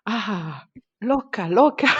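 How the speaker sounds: loud, high mock cry of the nurse; retold cry. Nurse crying out as the baby comes, loud and high. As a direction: call it out loud and high, half laughing. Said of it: "elle se met à crier".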